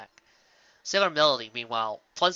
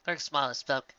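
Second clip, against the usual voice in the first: usual speaking voice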